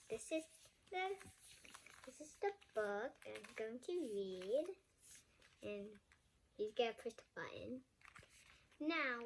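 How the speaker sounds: plain reading voice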